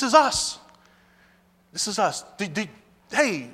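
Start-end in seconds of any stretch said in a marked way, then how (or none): none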